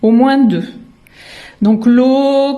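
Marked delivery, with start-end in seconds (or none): 1.9-2.6 "l'eau" higher and louder, vowel held long; stretched syllable. Shown as a demonstration. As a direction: draw "l'eau" out so each sound is heard. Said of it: "qu'est-ce que j'entends".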